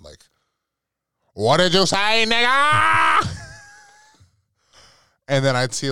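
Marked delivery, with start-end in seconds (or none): 1.3-3.3 high, very loud climbing yell; hammed-up imitation of a furious father; enraged dad. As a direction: roar it upward in outraged disbelief. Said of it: "craziest reactions out of my dad".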